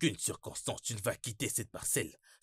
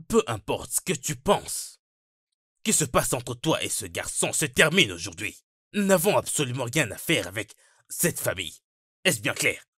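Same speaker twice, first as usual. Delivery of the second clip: stern repetition; 5 dB louder than usual. Acted. Father repeating his ban to Thelma, stern and loud. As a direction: spell it out loudly and firmly. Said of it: "Je vais le répéter pour toi".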